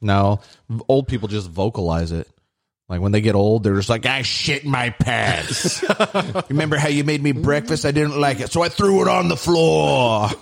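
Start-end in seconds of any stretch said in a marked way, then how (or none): 4.1-5.8 put-on old person voice. 6.5-10.3 mock cranky old person.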